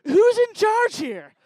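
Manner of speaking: high, very loud, drawn-out call; mock commanding shout. Host calling out the room, mock commanding shout. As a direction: holler it slowly as if demanding order.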